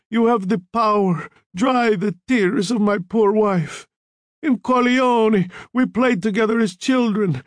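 high, impassioned begging of a dying man; feverish, high-pitched pleading; desperate dying plea. A narrator's reading. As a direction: beg for your life with tortured effort. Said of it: "The quavering voice grew louder, filling the room".

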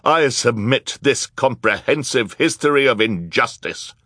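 triumphant courtroom declaration; triumphant announcement. A narrator's reading. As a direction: announce it proudly to the whole court. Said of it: "the triumphant voice of Jerome Squalor".

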